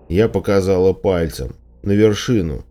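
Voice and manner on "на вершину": ordinary delivery, nothing marked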